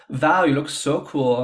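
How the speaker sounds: a bad, hammed-up German accent; terrible German accent. The Berlin designer complimenting Val, in a terrible German accent. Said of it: "in my terrible German accent".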